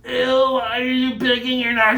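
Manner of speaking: high, loud grossed-out ew; mock disgust. Mock disgust at kids picking noses.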